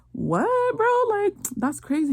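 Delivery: high-pitched disbelieving "what, bro"; shocked reaction. Retold or imitated. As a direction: squeak it out in disbelief. Friend reacting to shocking news.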